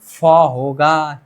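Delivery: फ होगा drawn out slowly, pitched low; teacher slowly modelling aspirated फ in फ होगा; pronunciation demo. Shown as a demonstration. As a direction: stretch the फ in फ होगा, low and deliberate. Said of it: "इसका उच्चारण अब क्या होगा".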